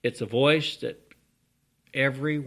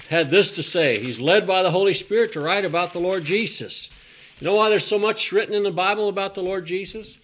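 louder, raised preaching voice; 5 semitones higher, 7 dB louder than usual. Real, not acted. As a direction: preach it out with force to the congregation. Preacher builds to full force.

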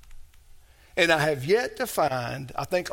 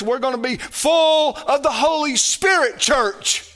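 preaching at full force; 6 semitones higher, 6 dB louder than usual. Acted.

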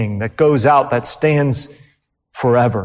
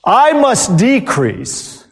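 slow, weighty quote; 6 semitones higher, much slower than usual. Acted. Preacher quotes John the Baptist.

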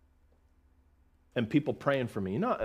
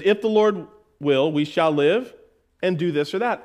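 scripture reading; 3 semitones higher, 5 dB louder than usual. Shown as a demonstration. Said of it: "He says".

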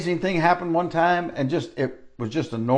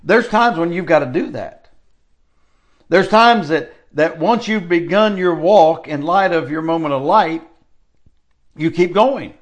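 preaching at full force; 3 semitones higher, 5 dB louder than usual. Acted. Preacher urges his listeners on, loud and fervent. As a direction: press the congregation to keep going.

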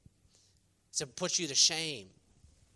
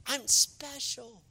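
smug mock pride; 7 semitones higher, much slower than usual. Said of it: "You're like".

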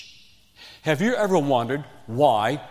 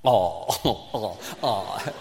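mock swooning aww; 6 semitones lower, 4 dB quieter, much slower than usual. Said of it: "And you sat there going".

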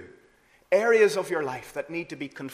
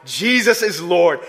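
heartfelt confession; 8 dB louder than usual. Shown as a demonstration. Preacher showing how a believer truly says it, sincere and full. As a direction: declare it as a true personal confession. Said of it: "truly, from the depths of the heart".

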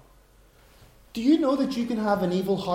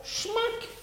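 1 mock smack sound; 8 dB quieter than usual. Imitating golf ball hit.

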